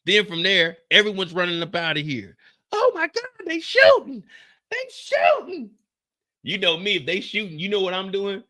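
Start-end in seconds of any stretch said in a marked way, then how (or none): 2.7-5.7 high frantic mock panic; high-pitched panicked wedding guest; panicked partygoer. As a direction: play a guest screaming at gunfire. Mock panicked crowd at shooting.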